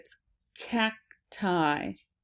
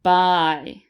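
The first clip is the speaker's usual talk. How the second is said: Bye called out louder, like waving someone off; a louder parting Bye to a friend; called goodbye; 6 dB louder than usual. Shown as a demonstration. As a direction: sing out a louder Bye as they leave. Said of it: "this is like when you're saying goodbye".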